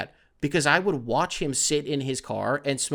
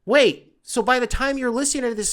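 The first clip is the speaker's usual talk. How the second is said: ordinary talk, nothing marked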